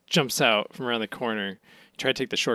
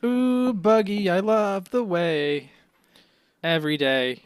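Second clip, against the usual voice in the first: swooning fan; 4 semitones higher, slower than usual. Pretend. Swooning over Buggy.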